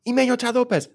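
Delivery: high-pitched imitation of calling out a misbehaving animal; exasperated farm woman. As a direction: call the emu's name, voice jumping high. Imitates farm woman scolding emu.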